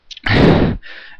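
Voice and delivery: loud frustrated exhale; a long, fed-up breath out; heavy sigh. Real, not acted. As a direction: blow out air in disgust. Fed up before ranting on O J.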